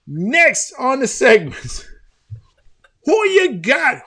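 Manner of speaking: high, loud, stretched-out announcer voice; segment announcer. Pretend. Host introducing the next segment, hyped announcer voice. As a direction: announce the segment like a big showman.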